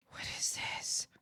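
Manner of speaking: breathless awed whisper. Real, not acted. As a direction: whisper it in wonder. Reacting in awe, whispered.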